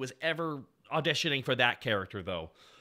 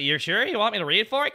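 lightly imitated incredulous actor, higher and louder; imitating voice actor; 6 semitones higher, 6 dB louder than usual. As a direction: ask it as a slightly put-on character. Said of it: "he kinda sounds like this".

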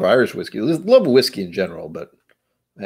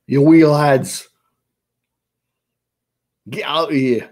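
loud, higher-pitched cartoon Scottish; bad Scottish accent; 6 semitones higher, 8 dB louder, slower than usual. Simpsons guy telling off lads, bad Scottish accent. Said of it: "I can do a bad one".

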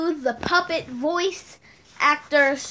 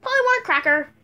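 high loud squawking parrot; 7 semitones higher, 4 dB louder, faster than usual. Pretend. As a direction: squawk it like a pet macaw. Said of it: "I do the blue and gold macaw".

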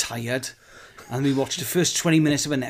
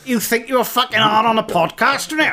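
loud, higher, hammed-up hard-man threat; mock aggressive shout; 10 semitones higher, 6 dB louder than usual. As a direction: square up like a pub brawler, all bluster. Mock pub fight threat.

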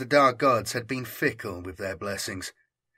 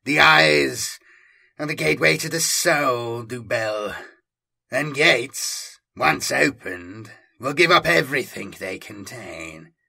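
rising menace; 4 dB louder, slower than usual. A narrator's reading. Lord taunting Dupel, loud gloating menace. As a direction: press the eyes hard, then savour it. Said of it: "Oedipus said".

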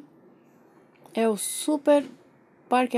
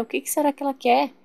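ordinary talk

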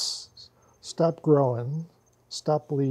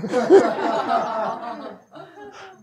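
loud laugh; 8 semitones higher, 7 dB louder than usual. Real, not acted. Laughing at the story.

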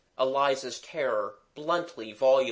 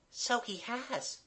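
put-on high, soft, slow girl's aside; hushed aside; 10 semitones higher, 7 dB quieter, slower than usual. A narrator's reading. Kathleen aside to Jimmy, hushed. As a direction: slip it in quietly, high and small. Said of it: "aside to Jimmy".

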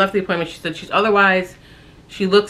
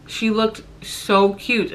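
tearful; 5 semitones higher, slower than usual. Real, not acted. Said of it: "I just started crying because".

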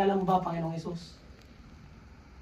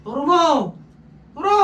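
a sharp, louder, higher order; commanding shout; 6 semitones higher, 7 dB louder than usual. Real, not acted. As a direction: bark the command at the spirit. Exorcist commanding the spirit. (malakas na utos)

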